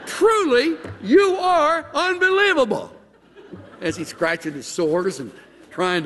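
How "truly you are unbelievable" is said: high, loud laughing mockery; sarcastic laugh. Job scoffing at his friends, high laughing sarcasm.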